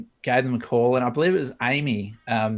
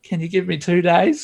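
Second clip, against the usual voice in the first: excited plea; 7 semitones higher, 6 dB louder than usual. Retold or imitated. Begging new boss on phone.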